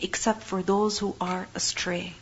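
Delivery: whispered, slow and grave; hushed whisper. Real, not acted. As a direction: drop to a soft whisper, slowly. Solemn close of verse translation.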